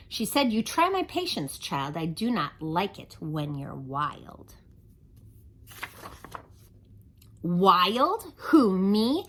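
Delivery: lively singsong rhyme reading; reading to children. A narrator's reading. Storyteller reading a rhyming book to kids, lively. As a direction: read the rhymes to the kids with bounce.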